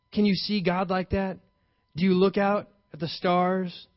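ordinary talk

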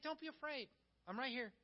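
softly calm the frightened one; gentle reassurance. Retold or imitated. Jesus reassuring the scared person, soft and gentle. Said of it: "And he's like".

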